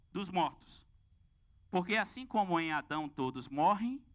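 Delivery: slow, solemn reading of scripture. Acted. Preacher reading First Corinthians to the congregation, slow and solemn. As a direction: read the verse slowly with weight, like from the pulpit. Reads Bible passage aloud.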